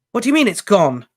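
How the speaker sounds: high, loud alarmed question; alarmed demand. A narrator's reading. Harry presses the elf in alarm. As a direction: demand it, dismayed.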